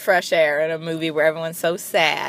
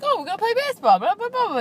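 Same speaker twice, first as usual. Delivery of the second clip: much higher, cheerful mock chatter; mock Woody Allen; 12 semitones higher, faster than usual. Vlogger mocks Woody Allen inviting kids to baseball, high and chirpy. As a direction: chirp it like an eager grown-up.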